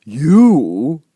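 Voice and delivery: loud, scornful creature voice; contemptuous You. A narrator's reading. Caterpillar scoffs at Alice, loud contempt. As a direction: sneer the word. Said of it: "said the caterpillar contemptuously".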